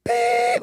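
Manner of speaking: high mouth-made beep; sustained high "beep" like a censor tone; imitated censor beep. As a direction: beep out the swear word in a high tone.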